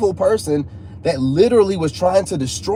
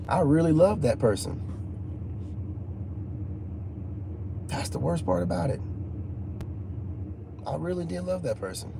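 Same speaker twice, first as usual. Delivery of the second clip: real subdued sorrow; 11 dB quieter than usual. Real, not acted. Man confesses he really loved the person who hurt him, subdued. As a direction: admit it softly, with long pauses.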